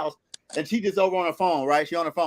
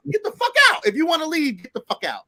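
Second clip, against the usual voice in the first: retold yelled order; 4 semitones higher than usual. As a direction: throw it at her, high and hot, then settle.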